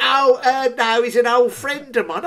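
put-on girl explaining. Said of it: "She went".

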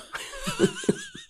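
high-pitched burst of laughter; amused laugh. Real, not acted. Host reacting to a story you couldn't write, amused laugh. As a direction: crack up laughing.

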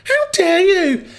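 high, slow, theatrical outrage; hammed-up high indignation; mock outrage. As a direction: gasp it like a scandalised aunt. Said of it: "lunch homophobia".

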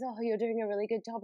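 a passing woman offering lightly voiced encouragement; kind stranger. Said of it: "this woman comes up to me and goes".

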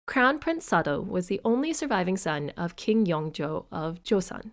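ordinary talk

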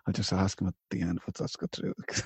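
soft, low mutter to oneself; inner thought. Retold or imitated. Co-host quoting own unspoken question, quiet inner voice. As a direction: say it under your breath, privately. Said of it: "in my head".

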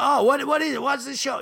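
mock the keen barber's curiosity; lightly voiced excited barber; barber's eager question. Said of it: "I won't do the accent".

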